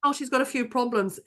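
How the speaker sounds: ordinary talk, nothing marked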